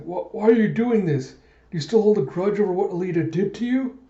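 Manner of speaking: hammed-up frightened protest; acted alarm. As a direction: plead upward in disbelief. Alarmed victim questions his attacker.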